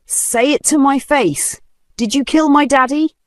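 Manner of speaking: high, breaking demand; voice cracking. Acted. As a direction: push through a cracking voice. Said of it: "her voice cracking".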